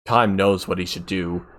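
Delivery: ordinary delivery, nothing marked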